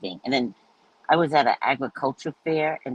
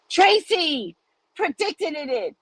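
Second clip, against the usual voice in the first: told-you-so; much slower than usual. Retold or imitated. Lamar's told-you-so quote.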